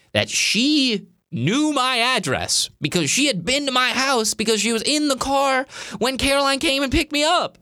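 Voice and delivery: high-pitched, mounting retold panic; alarmed realization. Speaker realizing the stranger knows her address, alarmed. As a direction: pile up the reasons, pitch held high.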